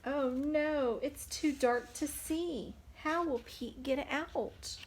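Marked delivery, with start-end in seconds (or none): none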